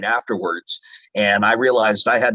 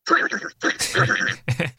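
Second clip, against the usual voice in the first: Donald Duck chuckle. Shown as a demonstration. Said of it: "compared to when he chuckles?".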